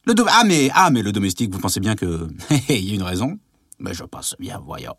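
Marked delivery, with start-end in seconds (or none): 3.8-5.0 whispered aside. Acted. Muttered reply.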